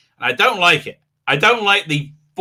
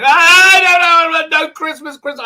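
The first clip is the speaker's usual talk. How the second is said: wailing Brits; 11 semitones higher, 8 dB louder than usual. Retold or imitated. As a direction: moan like a Brit whose Christmas is cancelled. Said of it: "all Brits are, like, going".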